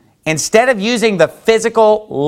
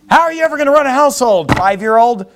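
mock scold; 6 semitones higher than usual. Mocking parent scolding small child.

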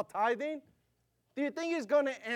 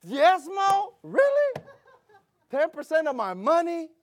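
high, loud shocked questions; incredulous; 9 semitones higher, 6 dB louder, slower than usual. Retold or imitated. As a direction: question it in scandalized disbelief. Acted shock at the Spanish word.

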